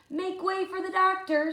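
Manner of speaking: calling out. Acted. Luther clears crowd.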